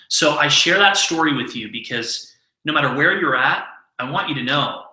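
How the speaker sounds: ordinary talk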